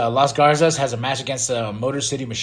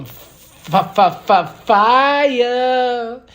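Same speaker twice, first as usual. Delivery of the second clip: high, loud stuttered build to a drawn-out word; building hype; hyped-up stutter; 10 semitones higher, 5 dB louder, much slower than usual. Real, not acted. Hyping up a promo.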